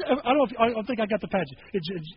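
ordinary delivery

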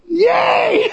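high shouted yay; a sudden joyful cheer. Real, not acted. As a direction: cheer it out like a fan. Cheering people bringing guests.

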